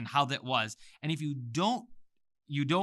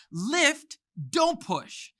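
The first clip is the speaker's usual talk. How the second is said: "Lift, don't push." said much higher and louder; 10 semitones higher, 4 dB louder, much slower than usual. Shown as a demonstration. Teacher leads class with lifted voice. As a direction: lift the voice right up. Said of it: "Come with me and say".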